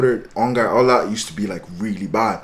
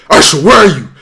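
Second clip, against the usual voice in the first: loud, raised re-enacted phone demand; demanding shout; 5 semitones higher, 13 dB louder than usual. Calling absent friend Asher on the phone, demanding shout.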